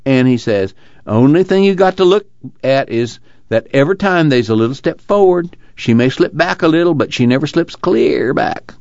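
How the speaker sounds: Casey, earnest storyteller. Acted. Casey tells Tom of a jailed union man, animated. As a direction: tell it keenly, voice lifted.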